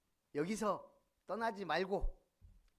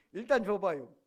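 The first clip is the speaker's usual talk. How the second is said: "일단 줘봐요" fired off louder, short and quick; quick clipped Chungcheong reply; 10 dB louder than usual. Shown as a demonstration. Preacher re-enacting Yun Bong-gil's terse answer, louder. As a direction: snap it back like a quick man. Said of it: "빨라".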